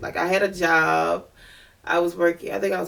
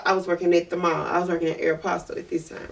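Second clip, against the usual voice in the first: normal talking voice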